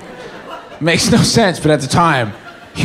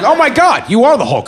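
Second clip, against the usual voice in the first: much higher gasp of awe; mock amazement; 7 semitones higher than usual. Seeing his friend turn green, higher and amazed. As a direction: act stunned by the sight. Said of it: "And I was like".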